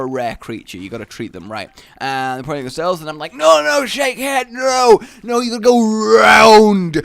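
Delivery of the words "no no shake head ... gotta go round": high, loud urgent exclaiming. Retold or imitated. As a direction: call out urgently through glass. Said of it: "really exclaiming it".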